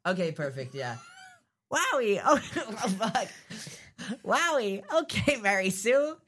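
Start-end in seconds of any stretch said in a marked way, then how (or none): 1.7-3.3 higher cartoon skeleton voice; Papyrus. Practising Papyrus's answer to Mary Sue, high-pitched. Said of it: "how to do Papyrus". 4.2-6.2 high, excitable skeleton voice; Papyrus. Acted. Papyrus agrees to Mary Sue's lunch request, eagerly. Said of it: "how to do Papyrus".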